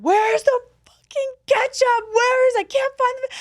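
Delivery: high, loud mock yelling, stretched out; hammed-up tantrum over missing ketchup; imitating angry brother. Teenage brother can't find the ketchup, mock furious yell. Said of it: "he would, got so mad".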